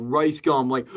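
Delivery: ordinary talk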